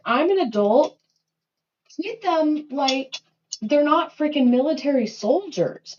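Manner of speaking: high-pitched, loud, exasperated mock rant; loud, high retelling of a fed-up parent ranting; retold rant. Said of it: "I'm like".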